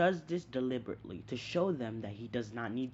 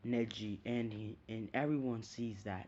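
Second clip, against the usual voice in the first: ordinary talk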